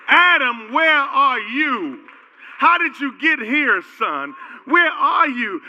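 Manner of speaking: high, loud call rising to a shout; God calling, building. Retold or imitated. God searching for Adam, rising to a shout. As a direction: cry out for the lost son.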